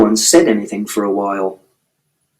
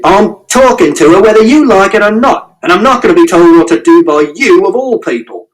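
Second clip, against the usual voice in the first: raised high, furious father yelling; angry shout; 7 semitones higher, 4 dB louder than usual. A narrator's reading. Angry father shouting at mother's partner at night. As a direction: yell it at the man in the doorway. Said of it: "And Father shouted".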